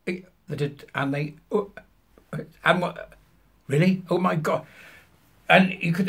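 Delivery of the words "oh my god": usual speaking voice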